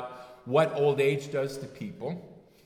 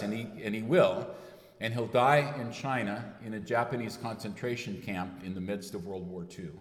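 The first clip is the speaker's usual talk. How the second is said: ordinary talk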